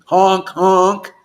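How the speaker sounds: loud goofy horn honks; honking horn. Pretend. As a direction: honk like a silly clown horn. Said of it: "I'm tooting Lord Chaitanya's horn now".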